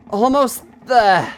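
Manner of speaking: slow, high strained push; strained effort. Acted. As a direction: force the words out with effort. Straining to reach, slower retake.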